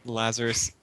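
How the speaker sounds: ordinary delivery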